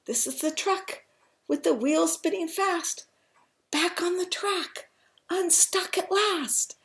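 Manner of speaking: high, excited read-aloud; triumphant storytelling. A narrator's reading. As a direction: build the happy news for children. Happy climax of picture book.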